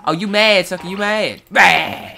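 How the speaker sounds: loud, high mocking jeer; taunting yell. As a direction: crow it loudly at a beaten rival. Loud taunting of beaten opponent.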